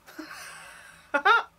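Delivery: high loud rueful laugh. Real, not acted. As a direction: laugh at the disaster. Shows the ruined hat.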